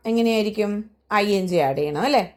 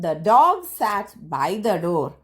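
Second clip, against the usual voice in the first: slow model reading; much slower than usual. Shown as a demonstration. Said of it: "teacher models English sentence".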